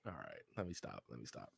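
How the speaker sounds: very low, hushed aside; reining oneself in. Real, not acted. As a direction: murmur it low, backing off a rant. Reins himself in after rant.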